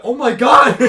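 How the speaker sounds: mock worried. Storyteller voicing his brother seeing the crash, mock worried. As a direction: exclaim it as a worried onlooker. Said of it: "he's looking back all worried".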